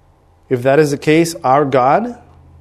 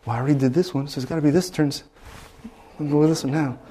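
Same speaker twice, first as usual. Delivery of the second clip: hushed scheming; 9 dB quieter, faster than usual. Retold or imitated. Scheming guesses during hearing test.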